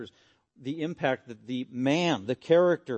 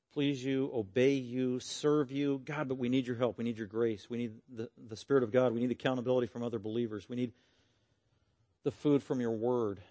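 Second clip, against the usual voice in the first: prayer; 2 semitones lower than usual. Real, not acted. Closing prayer to the congregation.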